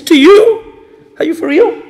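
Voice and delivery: high-pitched, loud mock outrage; indignant disbelief. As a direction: act out an affronted rich father's disbelief. Rich father rejects slave's proposal.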